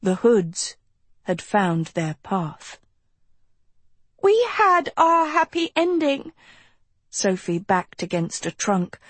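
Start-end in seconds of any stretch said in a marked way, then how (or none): 4.2-6.3 tearful. A narrator's reading. Sophie despairs, hoods closing in.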